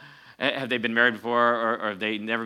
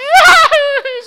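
high loud mocking wail, ending in a falling sound; 16 dB louder than usual. A preacher mocking a sobbing date in an ad, high wail. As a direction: ham up the date's sobbing wail.

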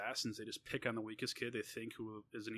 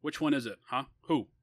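louder, higher demand for a name; insistent urging; 4 semitones higher, 11 dB louder than usual. A narrator's reading. Older sibling demanding the bully be pointed out, insistent urging. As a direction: press hard with punched short questions. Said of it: "I urged with untiring opportunity".

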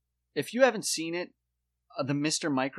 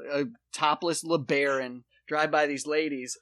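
ordinary talk

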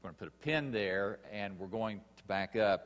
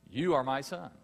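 higher, louder, firm proclamation; God's declaration; 6 semitones higher, 6 dB louder than usual. Acted. Preacher echoing God's words over Jesus, firm and proclaiming. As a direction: announce it with full authority. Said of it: "God has said of Jesus".

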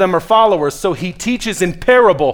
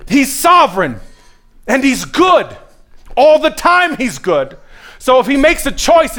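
high, loud, insistent preacher; full-force preaching; 7 semitones higher, 4 dB louder than usual. Real, not acted. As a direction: push the hard point on the congregation.

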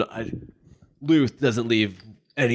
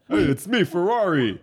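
high goofy voice introducing himself; high silly character voice; 7 semitones higher than usual. Acted. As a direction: chirp it out like a silly sidekick. Player voices character Fiero.

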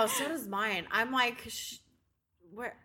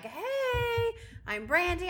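cheerful greeting voice; 8 semitones higher, much slower than usual. Retold or imitated. Bright greeting voice.

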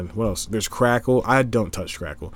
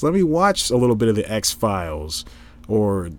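normal talking voice

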